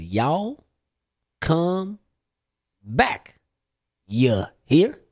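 each word stretched apart with long pauses, Southern lilt; slow Southern drawl. Retold or imitated. Southern cashier repeating to a puzzled teen, slowly. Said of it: "Could you say that a little bit slower?".

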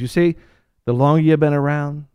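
normal talking voice

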